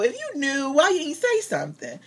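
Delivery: high-pitched baffled complaint; mock exasperation. Acts out baffled thought at pastor.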